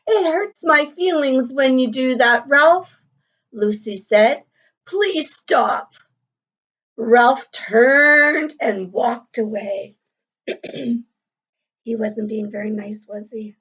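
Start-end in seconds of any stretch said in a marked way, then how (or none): 0.1-10.0 clear, lively storybook reading to a class; reading to children. A narrator's reading. Teacher reading a picture book to the class, lively and clear. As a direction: read the story aloud to young pupils.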